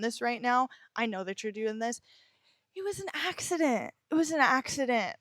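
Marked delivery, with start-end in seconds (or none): 2.7-5.2 mock excuse. Mimicking victim's excuse.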